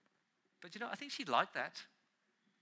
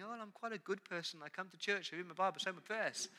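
imitating a smug churchgoer, softer and quick; mock complacent inner voice; smug self-talk; 3 semitones higher, 7 dB quieter, faster than usual. Preacher mocking self-satisfied listeners, light smug voice. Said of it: "sitting here tonight thinking".

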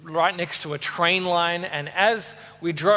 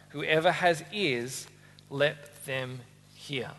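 measured, quiet Bible reading; scripture reading; 3 semitones lower, 4 dB quieter than usual. Acted. Preacher reading the parable to the congregation, measured and quiet. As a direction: read the parable steadily to the church. Reads the Gospel passage aloud.

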